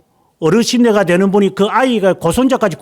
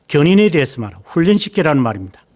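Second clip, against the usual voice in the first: usual speaking voice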